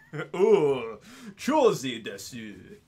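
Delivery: loud, drawn-out Japanese sign-off; exaggerated Japanese accent, long stretched syllables; Japanese impression. Streamer gives their best attempt at Japanese, hammed-up accent. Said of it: "that would be my, my best".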